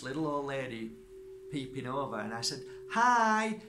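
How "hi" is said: high, loud call across the garden. Retold or imitated. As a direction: call up cheerfully to the neighbour. Greeting neighbour over the fence.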